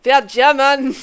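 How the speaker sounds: loud mock German accent. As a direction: bark it in a cartoon German accent. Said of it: "German be like".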